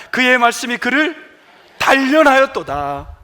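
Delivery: measured unison Bible reading; steady recitation of scripture. Acted. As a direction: read the psalm aloud with the congregation. Congregation reads psalm aloud.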